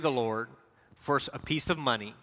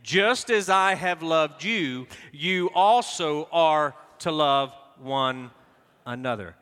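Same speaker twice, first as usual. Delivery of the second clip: slow, fuller, louder reading; read aloud together again; 3 dB louder, slower than usual. Shown as a demonstration. Pastor repeating the verse with the congregation, slow and firm. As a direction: lead them firmly through it. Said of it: "let's try it again".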